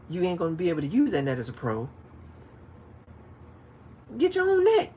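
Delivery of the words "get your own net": high, loud exasperated shout; loud retort. Retold or imitated. Angler telling boater to get his own net, loud shout. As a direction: fire it back at the pushy boater.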